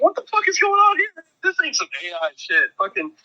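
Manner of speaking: high-pitched mock panic, easing then rising; freaking out. Storyteller filming the robot for Snapchat, mock freak-out. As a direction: warn everyone in hammed-up alarm. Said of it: "But I'm freaking out".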